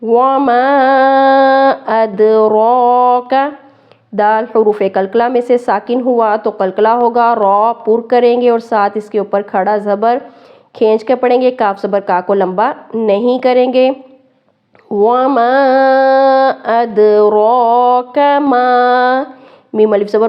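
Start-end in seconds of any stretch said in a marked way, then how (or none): 0.0-3.3 slow chanted tajweed recitation; Quran recitation. Shown as a demonstration. Teacher recites the whole verse for students. 14.9-19.2 slow chanted tajweed recitation; Quran recitation. Shown as a demonstration. Teacher recites the whole verse for students.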